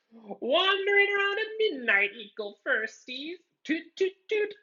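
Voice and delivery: very high, teasing poltergeist singsong; squeaky mischievous spirit mocking the kids. A narrator's reading. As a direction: taunt them like a giddy little ghost. Peeves taunting the students.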